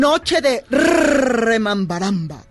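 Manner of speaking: slow, drawn-out show title; announcer style. Shown as a demonstration. Asked to say the show name properly, slow announcer delivery. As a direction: stretch every syllable of "Noche de Remambaramba" like a showman. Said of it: "Pero como lo dices tú ya bien".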